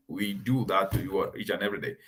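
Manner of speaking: usual speaking voice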